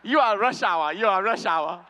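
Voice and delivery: imitated fan in a Chinese accent; mock Chinese accent. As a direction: insist with a comic Chinese accent. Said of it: "one guy was like".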